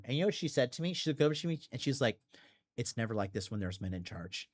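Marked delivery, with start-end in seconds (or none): 2.8-4.5 low, soft quoted remark; quiet aside. Retold or imitated. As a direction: drop down low and softer for her aside. Retells a woman's muttered aside.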